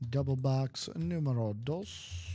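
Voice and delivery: slow, drawn-out announcement; announcer voice. Acted. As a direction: call it out like a game show host. Host announcing the break.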